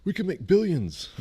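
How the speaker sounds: mock dad. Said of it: "my dad tried to talk me".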